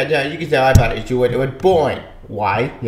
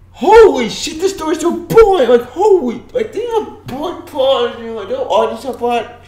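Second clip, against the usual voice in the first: high-pitched exasperated whine; exasperated rant; 10 semitones higher than usual. Real, not acted. Reviewer ranting about the boring story, exasperated. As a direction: complain in high, strained disbelief.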